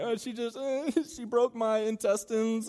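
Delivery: high, slow, hammed-up fake sob; mock crying. Consoling a crying friend, hammed-up high whimper. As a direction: wail it like a badly faked heartbreak. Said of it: "they're over there crying".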